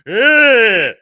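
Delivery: loud fake whiny cry; a jeering whimper; mock whine. Mocking a Lego figure with a loud fake whine.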